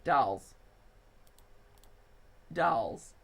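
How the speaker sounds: Midwest accent, open vowel. Real, not acted. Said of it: "your Midwest accent comes through".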